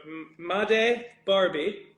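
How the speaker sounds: drawn-out slow reading of the name. Shown as a demonstration. Voice actor reading the name he wrote, slowly. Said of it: "I wrote".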